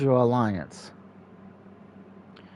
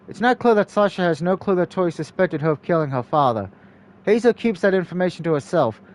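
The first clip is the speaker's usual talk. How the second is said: ordinary talk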